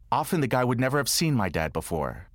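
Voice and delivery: usual speaking voice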